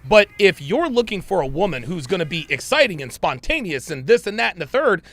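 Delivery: normal talking voice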